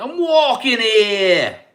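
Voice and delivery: loud, high, drawn-out New York yell; New York accent. Shown as a demonstration. Trying a New York accent for viewers, shouted New York caricature. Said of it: "New York accent".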